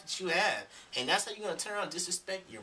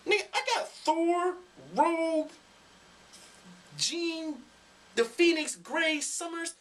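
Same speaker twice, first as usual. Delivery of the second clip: nerdy excitement; 9 semitones higher, 4 dB louder than usual. Real, not acted. Excited nerd listing comics.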